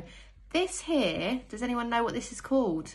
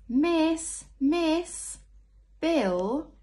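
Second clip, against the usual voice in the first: high, loud calling out; child calling miss; 8 semitones higher, 5 dB louder, much slower than usual. Acted. Teacher reading aloud, voicing a pupil calling miss. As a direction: call for the teacher's attention.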